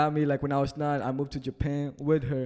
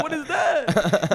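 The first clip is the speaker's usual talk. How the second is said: amazed; 13 semitones higher, 4 dB louder, much slower than usual. Retold or imitated. Retells first seeing Spam.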